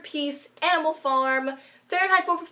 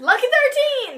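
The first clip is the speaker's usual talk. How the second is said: high excited shout; excited cheer; 10 semitones higher, 5 dB louder than usual. Real, not acted. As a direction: cheer it out brightly. Cheering a friend's number.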